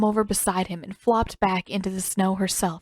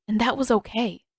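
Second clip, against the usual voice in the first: ordinary delivery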